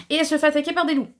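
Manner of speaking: high, loud, fast burst of alarm; dramatic recap. Acted. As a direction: announce the plot twist with mock panic. Péripétie racontée de façon théâtrale. (annonce théâtrale, aiguë et précipitée)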